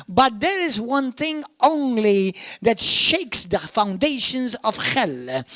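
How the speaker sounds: ordinary talk, nothing marked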